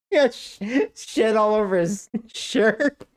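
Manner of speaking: high squeaky words between laughs; laughing. Real, not acted. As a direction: fight through helpless giggles. Laughing through the punchline.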